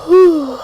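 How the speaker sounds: loud weary whew; tired sigh. Real, not acted. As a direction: blow out a long tired breath. Tired streamer, yawning.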